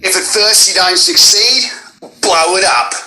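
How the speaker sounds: high, punchy explosives-lover about to blow things up; bombastic Junkrat. Acted. Auditioning as a bomb-loving game character, bombastic. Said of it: "pretend he's gonna, like, like, blow some shit up".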